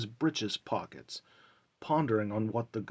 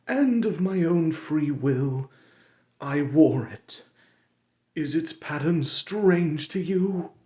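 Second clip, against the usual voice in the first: higher, louder mournful ghost voice; Marley's wailing ghost; 6 semitones higher, 6 dB louder than usual. Acted. Marley's ghost lamenting to Scrooge, higher and mournful. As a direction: moan it like a doomed spirit.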